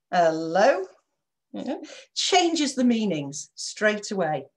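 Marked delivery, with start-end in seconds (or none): 0.1-0.8 a suspicious, doubtful hello; questioning hello. Shown as a demonstration. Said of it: "different tone of voice".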